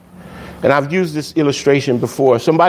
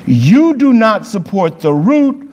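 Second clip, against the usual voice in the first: raised, slow pulpit reading; scripture reading; 7 semitones higher, slower than usual. Acted. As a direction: proclaim the verses slowly and high. Reads scripture aloud.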